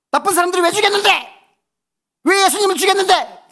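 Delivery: high, loud, relentless questioning; mock grilling shout. Dad cornering his nearly crying son, relentless mock shouting. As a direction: badger them as if driving them into a corner. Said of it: "막 코너로 막 몰고 갔지".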